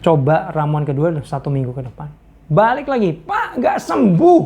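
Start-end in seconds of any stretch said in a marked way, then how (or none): 3.1-4.4 much higher, slow and indignant complaint; grumbling. Retold or imitated. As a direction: whine that it didn't work. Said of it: "Ngomel-ngomel kan". (mengomel tinggi dan lambat)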